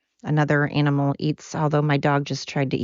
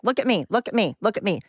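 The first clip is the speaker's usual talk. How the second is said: higher, insistent mock pestering; pushy attention-seeker; 4 semitones higher, faster than usual. Pushy yellow jackets cramming down their agenda, mock-insistent. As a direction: pester with the same plea, pitched up. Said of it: "really get in your face".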